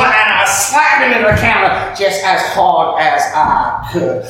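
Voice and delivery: normal talking voice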